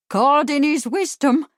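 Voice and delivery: high, loud exclamation; outraged cry. A narrator's reading. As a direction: cry it back in scornful outrage. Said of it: "cried the landlady".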